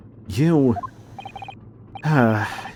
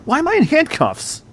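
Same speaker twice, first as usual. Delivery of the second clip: startled; 4 dB louder than usual. Acted. Wakes up handcuffed, reading dialogue.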